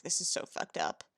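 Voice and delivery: ordinary delivery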